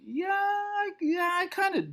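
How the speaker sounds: put-on a touch of a bashful young guy; sheepish self. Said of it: "I'm like".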